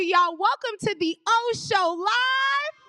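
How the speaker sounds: high, slow, stretched-out show welcome; announcer shout. Real, not acted. Host welcoming the crowd to the O Show Live, announcer shout. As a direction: rise big on the show's name.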